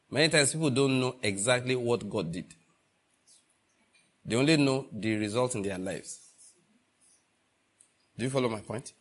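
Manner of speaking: usual speaking voice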